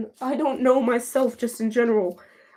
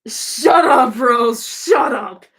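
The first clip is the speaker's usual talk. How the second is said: loud, raised-pitch outburst; shouted retort; 2 semitones higher, 6 dB louder, much slower than usual. Real, not acted. Streamer silencing Rose in chat, shouting. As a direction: shout down a teasing friend. Snapping back at teasing friend.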